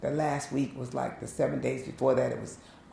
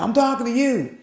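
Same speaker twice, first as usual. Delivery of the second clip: high, loud insistent shout; insistent call; 10 semitones higher, 9 dB louder than usual. Retold or imitated. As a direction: call out so she finally turns round. Drunk man insisting from back.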